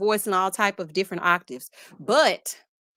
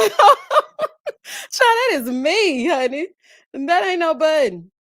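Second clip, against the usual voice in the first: laughing; 6 semitones higher, 7 dB louder, much slower than usual. Real, not acted. Laughing at viewer's claim.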